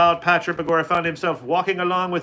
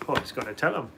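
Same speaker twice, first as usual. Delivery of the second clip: usual speaking voice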